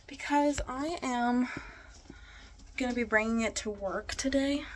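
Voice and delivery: usual speaking voice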